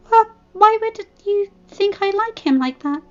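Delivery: high, stammering protest; flustered denial. Acted. Fluttershy denying she likes Discord, high flustered. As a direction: deny it in a fluster. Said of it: "she asked, denying it".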